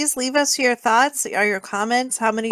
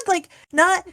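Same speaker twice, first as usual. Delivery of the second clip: ordinary talk, nothing marked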